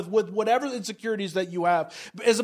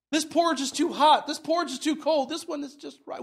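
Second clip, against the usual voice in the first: higher, lightly voiced Goldilocks judging porridge; a light, higher Goldilocks as she tastes; 6 semitones higher than usual. Acting Goldilocks tasting porridge.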